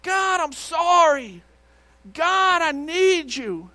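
high, loud imitated plea; crying out to God. Desperate man calling to God from his car, imitated cry. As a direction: call out in drawn-out, pleading bursts. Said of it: "he starts crying out to God".

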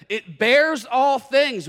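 "bears" loud and drawn out, slowly; stress on bears. Real, not acted. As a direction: lean hard and slow on "bears". Emphasizes verse ending.

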